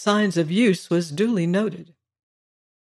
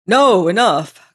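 louder, slow, cutting him off hastily; a hurried, louder cry of enough; hasty cry; 2 semitones higher, 7 dB louder, slower than usual. A narrator's reading. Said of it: "cried the gentleman concerned hastily".

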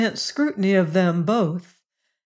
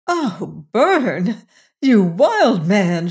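slow, louder delighted exclamation; exclaiming; 4 dB louder, much slower than usual. A narrator's reading. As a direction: greet him, half amazed. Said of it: "she exclaimed".

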